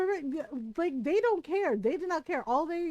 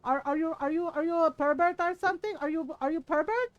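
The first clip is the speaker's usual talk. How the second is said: louder, higher mock Filipino aunties piling on; a chorus of Filipino titas, raised and scandalised; chiming Filipino titas; 6 semitones higher, 5 dB louder than usual. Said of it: "all my other titas are chiming".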